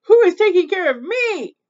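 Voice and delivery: high, loud wail of protest; angry cry. A narrator's reading. Overworked Little Witch protests to mother witch, tearful angry cry. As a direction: cry out, loud and hard done by. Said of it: "she cried".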